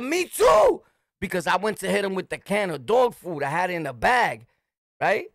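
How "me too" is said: high loud yelled comeback; shouted retort. Retold or imitated. Answering the screaming lady, a high shout. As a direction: throw it back at the lady, loud. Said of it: "I said".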